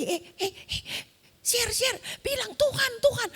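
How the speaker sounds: very high, squeaky nudge; high-pitched, insistent coaching from behind; urgent prompting friend. Retold or imitated. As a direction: mimic a friend urgently feeding the answer. Friend behind kicks, prompts her.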